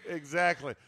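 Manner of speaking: ordinary talk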